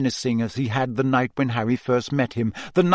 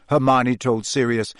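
plain reading voice

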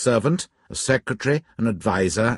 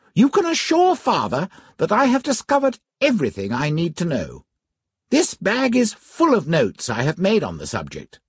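put-on young gentleman voice; 9 semitones higher than usual. Narrator voices young Cardinal.

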